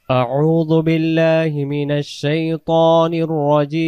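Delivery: slow, measured Arabic recitation; Quran recitation. Shown as a demonstration. Teacher opening the lesson with slow recitation. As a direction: recite it slowly, drawing out each word.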